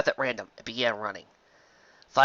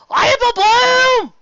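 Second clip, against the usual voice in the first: mock frantic shout; 13 dB louder, much slower than usual. Mimicking Applejack's frantic calling.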